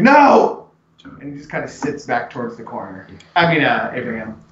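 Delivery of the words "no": high, loud no; old man shouting his refusal; refusing shout. Acted. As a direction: bark out a refusal. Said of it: "He's like".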